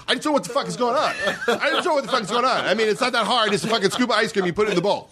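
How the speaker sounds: high, fast, laughing frustration; laughing rant. Retold or imitated. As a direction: rant at room service and crack up. Cursing at room service.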